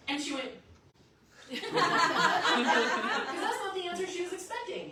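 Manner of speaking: usual speaking voice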